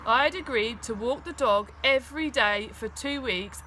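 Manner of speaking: ordinary delivery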